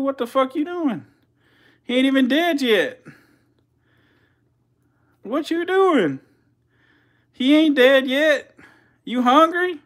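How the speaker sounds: high, loud shrieked astonishment; shrieking disbelief. Real, not acted. To the feeding snake, a high loud shocked shriek. Snake strikes before prey dead.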